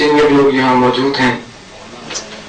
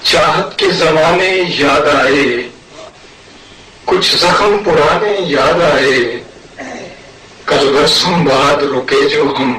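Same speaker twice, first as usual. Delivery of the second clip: measured ghazal recitation with long pauses; poetry recital. Acted. Poet presents his verses to a mushaira audience, poetry recital. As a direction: recite in separate phrases, lifting openings and letting ends fall.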